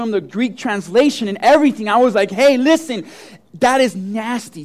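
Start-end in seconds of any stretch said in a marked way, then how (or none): 2.3-4.6 louder, insistent retelling with weight on NASTY; emphatic warning. Retold or imitated. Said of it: "I emphasized the word".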